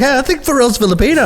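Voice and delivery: excited. Retold or imitated. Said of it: "I'm like".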